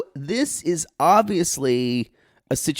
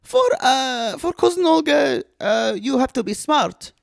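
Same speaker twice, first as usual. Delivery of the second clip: put-on halting comic Eastern European accent; cousin Olga; 10 semitones higher than usual. Visiting cousin on the covid year, swooping accented voice.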